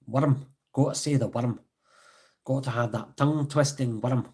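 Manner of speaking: "Worm" with a rolled Scottish R. Shown as a demonstration. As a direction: roll the tongue through the R in "worm". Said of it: "It's like rolling the tongue".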